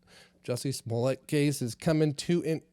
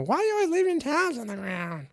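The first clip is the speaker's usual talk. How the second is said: much higher, cartoonish nag like Marge Simpson; mock nagging wife; 12 semitones higher, 3 dB louder than usual. Mimicking his wife's towel complaint in a much higher cartoon voice. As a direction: squeak it out like a nagging cartoon mom. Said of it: "You sound like Marge Simpson".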